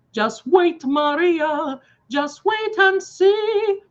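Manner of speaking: lightly acted Anita warning Maria; a stern sisterly warning, building up. Anita warning distraught Maria about her boyfriend's killer, rising intensity. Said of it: "I was Anita ... I did this".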